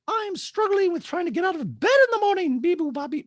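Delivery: hammed-up squeaky impersonation; Dorit. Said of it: "And Dorit's like".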